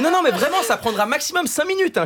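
high-pitched, pleading caller imitation; insistent high mock caller; insistent phone pollster. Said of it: "Elle m'a dit".

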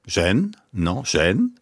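"gêne" repeated with the voice going up; rising-tone correction. Shown as a demonstration. Sinologist drills the host on the word, rising-tone correction. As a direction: model "gêne" climbing in second tone, correcting with a "Non". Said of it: "la voix monte".

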